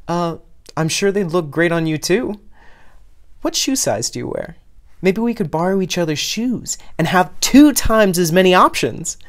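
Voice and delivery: light up with a fun plan; beaming. Acted. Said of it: "Reki beamed at the new idea".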